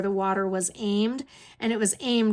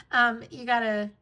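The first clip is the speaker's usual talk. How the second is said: normal talking voice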